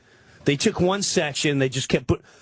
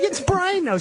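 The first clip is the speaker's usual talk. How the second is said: much higher, pleading excuse. Retold or imitated. Husband pleading with wife for Brian, pitched high. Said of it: "I was like".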